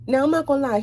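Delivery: usual speaking voice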